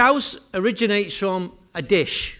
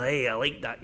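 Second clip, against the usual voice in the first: lower, softer, a lightly voiced Scotsman; a quick low nod to the old Scots manager; imitating Bill Shankly; 7 semitones lower, 9 dB quieter than usual. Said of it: "He was like Popeye".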